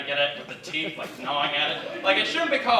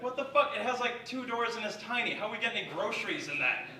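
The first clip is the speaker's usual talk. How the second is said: exasperated wife; 7 semitones higher, 7 dB quieter than usual. Retold or imitated. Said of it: "He's like".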